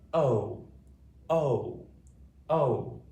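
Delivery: loud, held long O for practice; long O modelled. Shown as a demonstration. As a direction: call out the long O for them to repeat. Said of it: "Say it with me".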